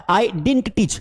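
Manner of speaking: didn't as one syllable DINT; correct pronunciation. Shown as a demonstration. Teacher gives class an example, didn't said DINT. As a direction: say didn't as DINT within the sentence. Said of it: "इसे didn't पढ़ा जाता है".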